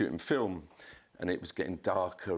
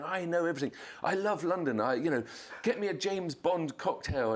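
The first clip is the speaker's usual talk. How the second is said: mocking Courtney; 10 semitones higher than usual.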